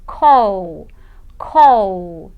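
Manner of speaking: low, drawn-out "call", held on the L; voiced final sound. Shown as a demonstration. Teacher shows the next vibrating word, lengthened "call". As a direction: stretch "call" so the ending vibrates. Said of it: "Ele vibra também".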